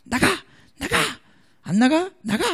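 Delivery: imitating a disciple barking at the demon, up high; playfully hammed-up command to get out; mock exorcism shout. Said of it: "말로 꾸짖어야 돼". (장난스럽게 흉내 낸 귀신 꾸짖는 고함)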